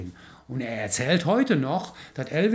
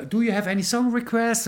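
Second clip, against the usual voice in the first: high, lively English voice; chirpy host asking for requests; imitating Mike; 8 semitones higher than usual. Mike asking the family for song requests, lightly imitated.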